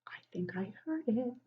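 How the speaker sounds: soft unvoiced whisper, as if hearing a child. Teacher pretending to hear a child's answer, whispering. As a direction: lean in and whisper to the listening kids.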